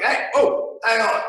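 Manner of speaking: sharp, high mock interruption; annoyed hey hang on. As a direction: cut them off in irritation. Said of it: "I was like".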